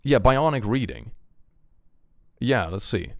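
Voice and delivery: usual speaking voice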